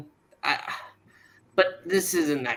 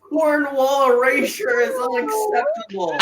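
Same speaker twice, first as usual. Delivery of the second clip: mock outrage; 10 semitones higher, 4 dB louder than usual. Mock outrage over a character.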